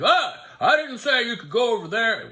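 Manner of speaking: hammed-up irritated rebuke; mock angry attendant. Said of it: "he got mad at me".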